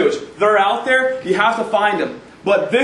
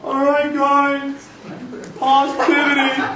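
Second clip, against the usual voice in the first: higher, drawn-out hype-coach call; mock motivational guru, pitched up; Anthony Robbins impression; 5 semitones higher, slower than usual. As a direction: pump up a crowd like a self-help guru. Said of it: "You ever see Anthony Robbins like".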